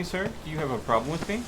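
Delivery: ordinary talk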